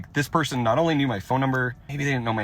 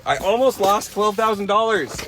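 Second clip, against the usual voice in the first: louder, emphatic restatement of the loss; ALMOST stressed in a rattled raised voice; distressed exclamation; 9 semitones higher, 6 dB louder than usual. Real, not acted. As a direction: hammer home how much was nearly lost. Upset repeat, louder.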